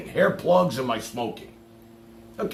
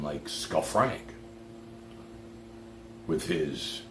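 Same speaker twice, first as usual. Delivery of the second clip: meek monotone; 9 semitones lower, 10 dB quieter, much slower than usual. Retold or imitated. Mocking a meek monotone man.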